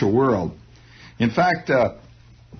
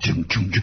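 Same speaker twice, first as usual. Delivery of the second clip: imitated tyre thumps; 8 semitones lower than usual. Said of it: "tyres over slots".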